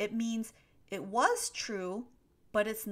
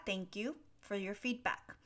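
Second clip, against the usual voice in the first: ordinary delivery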